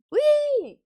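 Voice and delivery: high, loud "whee"; playful whee. Pretend. Breaking up a story about a job, playful squealed whee.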